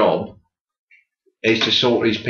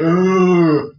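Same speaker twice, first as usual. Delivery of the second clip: high loud ugh; a short groan; corpse groan; 6 semitones higher, 4 dB louder than usual. Retold or imitated. Undertaker imitating the corpse groaning. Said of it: "He's going".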